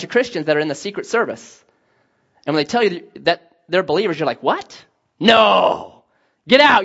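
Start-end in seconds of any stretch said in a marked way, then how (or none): 4.4-6.8 mock shock. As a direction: act floored, bursting out in disbelief. Acted shock at learning they're believers.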